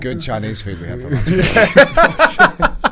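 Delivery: slow, high, loud, laughing; hammed-up slow drawl; slow silly delivery. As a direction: drag it out with a laugh. Joking slowed line for camera.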